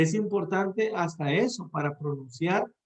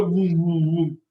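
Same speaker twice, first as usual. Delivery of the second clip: mock mic pop; 6 dB louder, slower than usual. Shown as a demonstration. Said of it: "le va a hacer".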